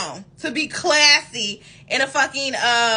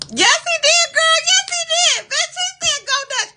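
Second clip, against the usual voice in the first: much higher, loud shrieking disbelief; outraged disbelief; 5 dB louder than usual. Real, not acted. Telling viewers the date wanted to split the bill, shrieking in disbelief. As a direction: shriek it like you can't believe it.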